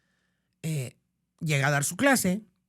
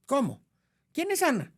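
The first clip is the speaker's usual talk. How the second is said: startled question; 7 semitones higher than usual. Retold or imitated. Teacher shocked by girl's words.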